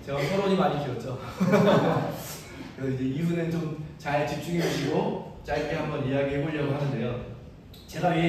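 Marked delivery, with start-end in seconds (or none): 1.2-2.7 short laugh at oneself; easy apologetic chuckle; self-conscious laugh. Real, not acted. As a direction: laugh off your own long-windedness. Laughs after admitting long introduction.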